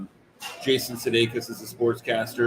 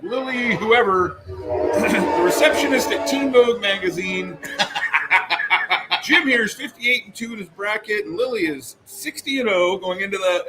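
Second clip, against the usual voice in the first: higher, louder announcer bit; mock broadcast intro, a bit louder; hammed-up sportscaster; 7 semitones higher, 4 dB louder, slower than usual. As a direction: play the cheesy TV host introducing guests. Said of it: "he's like".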